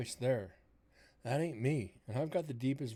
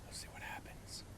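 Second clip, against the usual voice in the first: whisper; 11 dB quieter than usual. Real, not acted. Hushed aside during ghost hunt.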